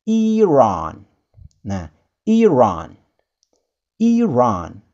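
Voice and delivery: Iran with the second vowel stretched; Iran said slowly, drawn out at the end; teaching Iran the English way; lengthened Iran. Shown as a demonstration. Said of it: "Sedikit ada perpanjangan di sini".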